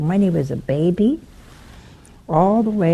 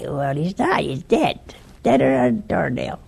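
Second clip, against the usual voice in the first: usual speaking voice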